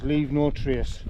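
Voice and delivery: slow, deliberate, each word set apart; slow motto. Shown as a demonstration. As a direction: spell out the motto slowly. As always.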